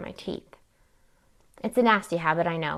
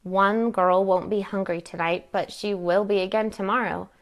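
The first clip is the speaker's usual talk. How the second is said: ordinary delivery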